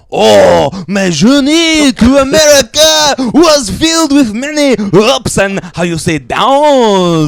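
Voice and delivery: put-on high, slow French snake accent. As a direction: ham up the vain snake. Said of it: "That was [name] doing the snake voice".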